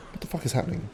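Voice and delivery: whispered disbelief; a breathy whispered what-the-hell; inner thought. Retold or imitated. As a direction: hush it like a private thought. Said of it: "is what I'm thinking at the time".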